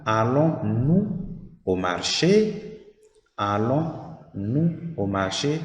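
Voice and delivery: "Allons-nous au marché?" slowly, rising on "marché"; slow question rise. Shown as a demonstration. Teacher models the inverted question, rising on "marché". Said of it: "here it will become".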